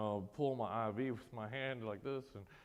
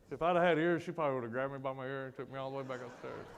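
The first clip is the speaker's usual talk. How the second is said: ordinary delivery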